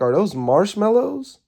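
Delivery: high, slow disbelieving question; surprised. Retold or imitated. To herself, staring at a half-gone drink, high and baffled. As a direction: squint at the cup and ask, baffled.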